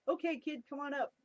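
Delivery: imitating boss calling. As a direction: call the kid back up, casual. Boss calling her upstairs.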